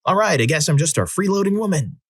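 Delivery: high-pitched indignant outburst, little added volume; mock yelling. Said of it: "I started yelling".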